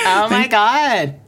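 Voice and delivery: put-on Valley girl. Co-host echoing the niece impression, drawled Valley girl. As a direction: draw it out in mock Valley girl. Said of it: "still a Valley girl".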